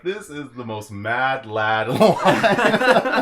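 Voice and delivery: loud, slow, laughing through the words; cracking up while saying it; real laughing disbelief; laughing delight. Real, not acted. Reacting to a movie line.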